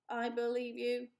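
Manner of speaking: even, measured machine voice; robot voice. Acted. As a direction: speak like a polite robot. Robot replies.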